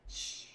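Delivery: sharp "shh"; imitated hush; shushing. Husband cuts off his gushing wife, shushing. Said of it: "which he shushed me".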